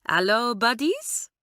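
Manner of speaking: slow, friendly hallo with a lightly voiced French lilt; amiable French girl. A narrator's reading. French girl cheerfully greeting her new classmates, slow and lightly voiced. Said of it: "said Claudine amiably".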